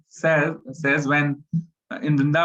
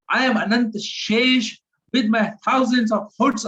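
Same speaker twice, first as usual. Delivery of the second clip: Balram's furious challenge; 6 semitones higher, 3 dB louder than usual. Retold or imitated. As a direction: roar like a wrathful elder brother. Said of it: "Balram says to everybody".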